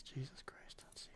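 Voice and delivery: exasperated whisper under breath; muttered under breath. Real, not acted. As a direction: sigh it out in a whisper.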